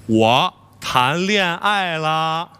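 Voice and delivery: very slow, low reveal; slow, weighty confession with long held syllables; momentous announcement. Acted. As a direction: draw out the big news word by word. Grand announcement of big news.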